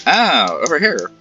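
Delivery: ordinary delivery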